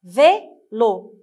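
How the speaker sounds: "Vélo" as VÉ, pause, LO; syllable by syllable. Shown as a demonstration. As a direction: split "Vélo" into two loud beats. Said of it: "lisez avec moi".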